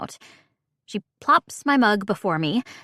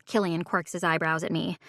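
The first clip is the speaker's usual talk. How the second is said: ordinary delivery, nothing marked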